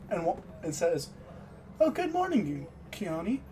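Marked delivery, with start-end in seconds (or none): 1.8-3.4 greeting that jumps much higher on oh; startled greeting. Acted. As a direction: caught off guard, greet her brightly.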